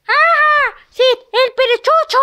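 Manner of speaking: high, loud frightened cry; terrified scream. Acted. The sheep sees Sid and screams in terror. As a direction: scream his name in fright.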